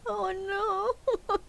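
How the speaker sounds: usual speaking voice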